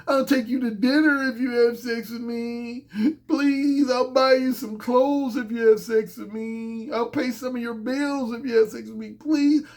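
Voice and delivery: drawn-out, whiny begging; slow wheedling plea, stretching the words; pleading. Acted. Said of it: "in any type of way like".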